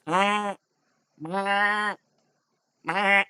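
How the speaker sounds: small spaced-out bleats from a goat. Acted. Game master as goats spoiling the stealth, bleating. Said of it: "the goats are going like".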